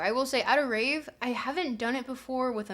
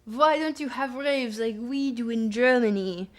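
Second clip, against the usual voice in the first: slow, louder, badly faked German accent; poor German accent; 2 semitones higher, 5 dB louder than usual. Streamer asks chat about raves in a mock German accent. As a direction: ham up a clumsy German accent. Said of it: "that's my really poor German accent".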